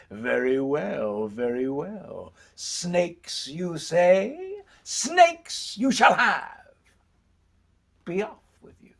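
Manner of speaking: slow, stretched words with a rising menace; ominous old woman. Acted. Old woman answering the middle brother, ominous and drawn out. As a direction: draw it out slowly as the old woman.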